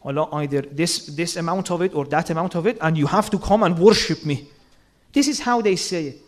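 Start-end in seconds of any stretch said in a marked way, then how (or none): 0.0-4.5 booming demand, as a strict master who orders; harsh commanding God. Retold or imitated. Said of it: "but they translate it like this".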